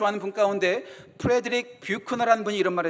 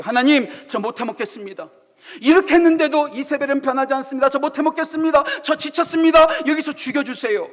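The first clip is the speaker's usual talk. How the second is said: louder, higher desperate complaint; exaggerated Elijah; 5 semitones higher, 7 dB louder than usual. Elijah begging God to let him die, exaggerated despair. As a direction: cry out to God in worn-out despair. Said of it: "제가 조금 과하게 말씀드릴게요".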